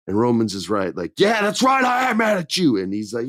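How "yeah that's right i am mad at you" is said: much higher, mock indignant; mock snap. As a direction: fire it back in a put-on high voice. Roman snapping back.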